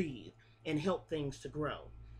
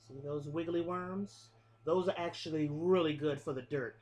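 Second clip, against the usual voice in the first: ordinary delivery, nothing marked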